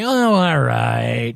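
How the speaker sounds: slow, drawn-out reluctant give-in; caving in. Retold or imitated. Public finally giving in to repeated words, slow resigned mock. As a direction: sigh it out as if worn down. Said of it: "you'll cave and go".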